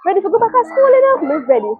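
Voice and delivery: put-on high, loud little-girl voice; imitating her little daughter. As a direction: chirp it like an eager toddler. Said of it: "she said".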